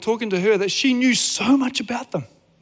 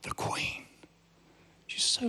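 a reverent whisper as she walks in; awed whisper; 15 dB quieter than usual. Acted. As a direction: breathe the Queen in a hushed whisper. Awed hush as Queen enters.

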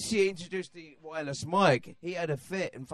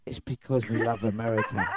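low-pitched mock American accent; put-on American accent; 7 semitones lower than usual. English singer answering why accents vanish, put-on American accent. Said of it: "their accent go away".

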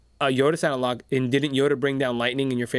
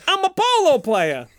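high-pitched hammed-up holler; over-the-top high announcement; mock hero shout; 12 semitones higher, 4 dB louder than usual. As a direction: proclaim it high, like a cartoon hero. Acted meeting of two space heroes.

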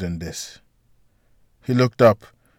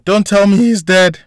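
high, loud, frantic scream; panicked woman yelling in the hallway; 10 semitones higher, 7 dB louder than usual. A narrator's reading. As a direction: shriek it in terror. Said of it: "in a panicked voice yelled out".

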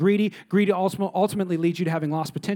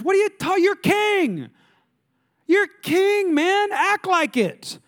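pleading courtier, high and slow; exasperated courtier; 13 semitones higher, 4 dB louder, slower than usual. Retold or imitated. Courtiers urging insecure Saul, exasperated high plea. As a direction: beg the king to get a grip. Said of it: "people in his court going like".

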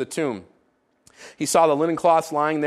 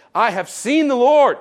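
higher, louder joyful cry; joyful announcement; 7 semitones higher, 6 dB louder than usual. Acted. Mary telling the disciples her news, higher and louder with joy. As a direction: burst out with the news.

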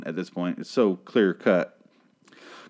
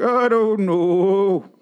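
high, long drawn-out mock wail; exaggerated mock cluelessness; 10 semitones higher, 4 dB louder, much slower than usual. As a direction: stretch every word out in silly high mockery. Mock quote of a clueless character.

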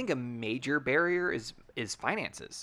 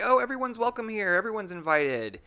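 high, louder breezy welcome; higher-pitched, over-friendly host quote; mock welcoming; 11 semitones higher, 5 dB louder than usual. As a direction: put on a gushing open invitation. Voicing an insincere open invitation.

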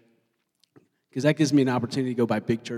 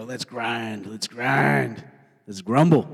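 grumbling rally; 4 semitones lower, much slower than usual. Acted. Crowd easily roused to grumble, gruff building chant. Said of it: "It's easier to go".

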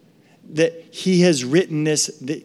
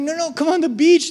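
coaxing parent; 11 semitones higher than usual. Retold or imitated.

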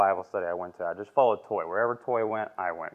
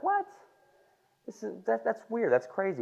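much higher, startled and baffled; high incredulous what; shocked disbelief; 13 semitones higher than usual. Retold or imitated. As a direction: voice the wife caught off guard. Wife's shocked reaction retold.

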